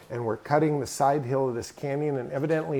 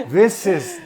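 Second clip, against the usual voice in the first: laughing; 6 semitones higher, 12 dB louder than usual. Real, not acted. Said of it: "He's not telling the story very well".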